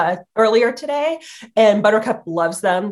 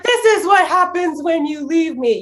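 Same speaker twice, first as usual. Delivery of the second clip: higher, put-out dog voice; voicing the dog; 7 semitones higher than usual. Dog scolds owner for leaving, in a higher put-out voice. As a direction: scold like a clingy pet. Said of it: "she's like".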